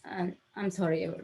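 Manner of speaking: soft, halting, real emotion; overcome with emotion. Real, not acted. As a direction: apologize softly while choked by feeling, with long pauses. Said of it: "I'm being emotional".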